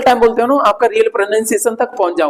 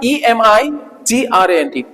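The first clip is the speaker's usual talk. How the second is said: slow spelling out; much slower than usual. Shown as a demonstration. Teacher spells the word out for students, slowly. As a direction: spell ईएमआईजीआरएनटी letter by letter, slowly. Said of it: "इसको पढ़ा जाता है emigrant".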